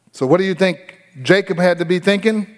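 ordinary talk, nothing marked

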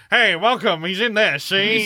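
a hammed-up tough-guy "see?"; gangster voice. Podcaster imitates Data playing a gangster, mock tough-guy. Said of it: "doing the gangster voice".

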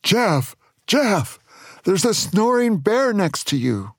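moderately high acted shout of alarm. A narrator's reading. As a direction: call out a storybook warning. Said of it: "Then Chuck shouted".

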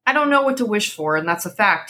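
ordinary delivery, nothing marked

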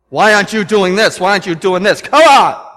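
loud, scolding pulpit yell; his old mad preaching voice; angry preaching. Retold or imitated. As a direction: berate the congregation. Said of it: "I would get up there in my tone".

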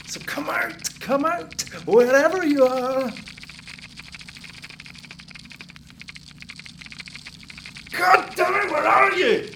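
high, loud, rising taunt; raging yell. Acted. Man screams into the walls at hiding rats, enraged. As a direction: scream at them to come out. Hunting rats, losing temper.

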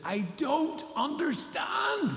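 high, slow drawn-out cry; anguished cry. Real, not acted. Worshipper crying out to God, high and slow. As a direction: let it rise out of you high and slow.

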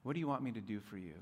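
soft, quick, tender repeat; gentle echo. Shown as a demonstration. Preacher repeating Jesus' question gently. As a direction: let the question land softly. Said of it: "repeated softly for emphasis".

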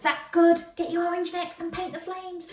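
plain reading voice

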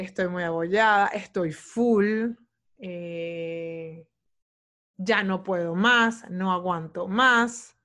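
slow, drawn-out tired complaining; imitating her old overwhelmed self, slowly; mock overwhelmed complaint. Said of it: "¿Qué era lo que yo decía?".

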